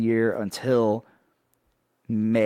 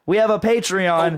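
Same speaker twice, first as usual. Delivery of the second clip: louder, high-pitched repeated reveal; mock hype; 8 semitones higher, 6 dB louder than usual. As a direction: announce it as if it were huge news.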